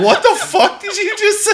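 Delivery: high, laughing disbelief; incredulous laugh. Retold or imitated. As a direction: react in stunned amusement. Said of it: "It's like".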